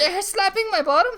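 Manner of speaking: high loud complaint; whiny distress. Acted. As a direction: whine in pain. Being spanked.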